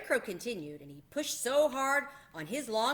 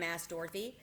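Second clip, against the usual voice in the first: plain reading voice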